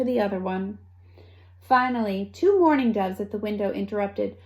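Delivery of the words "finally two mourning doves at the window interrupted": ordinary delivery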